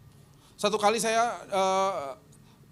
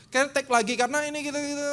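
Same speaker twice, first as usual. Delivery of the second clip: put-on 1 young musician; 7 semitones higher than usual.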